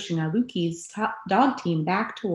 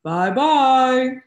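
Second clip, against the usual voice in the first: louder big bear goodbye; big Papa Bear voice; 6 semitones higher, 7 dB louder than usual. Shown as a demonstration. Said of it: "big, deep Papa Bear voice".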